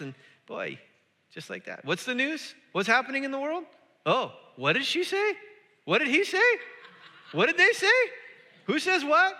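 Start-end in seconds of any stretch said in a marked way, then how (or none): 1.8-9.3 high-pitched, mock-agitated questions, building louder; frantic news-checking. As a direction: work yourself into a hammed-up tizzy. Acted frantic scrolling of morning news.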